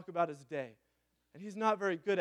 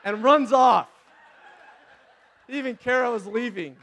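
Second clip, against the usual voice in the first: laughing through the punchline, much louder; 6 semitones higher, 14 dB louder than usual. Real, not acted. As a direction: giggle it out in disbelief.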